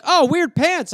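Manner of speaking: high mocking classmates; high mock classmate jeer; school kids. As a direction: tease like schoolkids. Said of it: "they'd be like".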